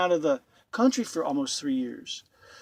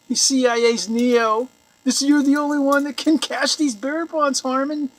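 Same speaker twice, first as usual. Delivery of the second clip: high, loud, hammed-up hero worship; mock awe; 7 semitones higher, 7 dB louder than usual. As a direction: hail him sarcastically as the chosen one. Mocking acted flattery of Harmon.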